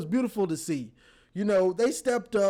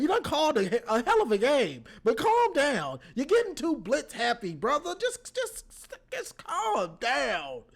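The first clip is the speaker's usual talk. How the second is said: rising higher, pleading with him to calm down; exasperated pleading; 6 semitones higher than usual. Real, not acted. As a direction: beg him to stop, half laughing. Pleading with coach to stop blitzing.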